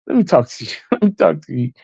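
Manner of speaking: pleading line broken by laughs; laughing drunk whisper imitation. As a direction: mimic the begging while cracking up. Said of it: "He's whispering".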